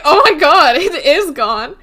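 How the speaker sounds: loud, high gasp of delight over the vanished coin; excited Aziraphale. Retold or imitated. Acting Aziraphale thrilled by his own trick, loud and excited. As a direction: burst out thrilled that the trick worked. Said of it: "looks so excited that it worked".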